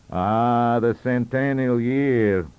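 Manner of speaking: put-on slow, loud, drawn-out old statesman's muse; musing Sir John. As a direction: savour the phrase like a wine.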